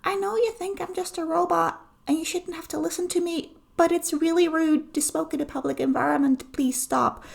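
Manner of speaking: high, voiced robot plea; a polite robot asking firmly. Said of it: "the robot goes".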